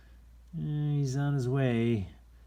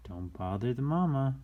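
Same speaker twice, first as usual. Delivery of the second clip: plain reading voice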